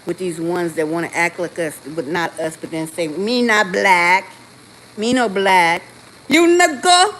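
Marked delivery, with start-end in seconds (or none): 3.1-7.1 slow, exaggerated mock African accent, loud at the end; imitating an African accent, mocking. Mocking African detractors in a put-on African accent. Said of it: "I don't have an African accent like that".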